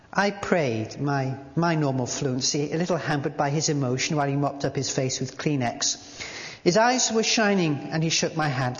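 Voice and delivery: usual speaking voice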